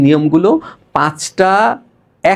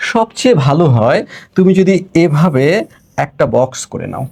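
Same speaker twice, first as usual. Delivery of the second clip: usual speaking voice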